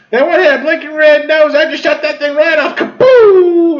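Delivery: high-pitched gleeful boasting; hammed-up excited hunter; trigger-happy shooter. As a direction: crow it in falsetto, ending on a boom. Voicing the trigger-happy aunt.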